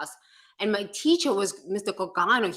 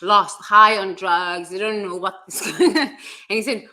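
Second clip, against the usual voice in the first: normal talking voice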